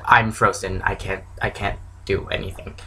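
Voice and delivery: retold stunned paralysis, voice dropping away softly; halting re-enactment, fading almost to nothing; frozen, starstruck. Fan reliving spotting the actor in the street, stunned and fading. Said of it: "the moment of, "I'm frozen".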